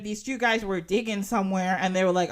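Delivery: normal talking voice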